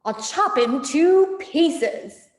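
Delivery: slow, loud gloating threat; menacing farmer. Acted. Farmer Bunce gloating about chopping up the fox. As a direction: savour the threat.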